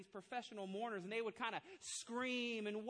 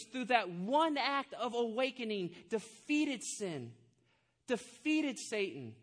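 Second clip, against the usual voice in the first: loud, slow, rising sermon climax; full-force preaching; 10 dB louder than usual. Acted. Pastor proclaiming the resurrection to the congregation, full force.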